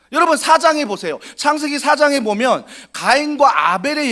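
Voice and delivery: loud, high, driving sermon voice; fervent preaching. Real, not acted. Pastor urges the congregation with fervent, loud preaching. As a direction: preach it out with full force.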